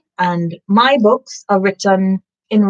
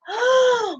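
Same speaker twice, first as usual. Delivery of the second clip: amazed gasp; 13 semitones higher than usual. Acted. Reader gasping in wonder at the library. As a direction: gasp as if seeing it yourself.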